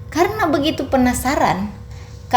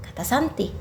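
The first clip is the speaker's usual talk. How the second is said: plain reading voice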